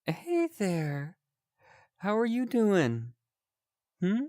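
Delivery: chirpy friendly character; high cheerful voice. Acted. Higher friendly character greeting.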